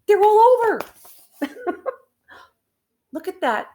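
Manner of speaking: thrilled exclamation; excited. Real, not acted. Reacting to animals scattering.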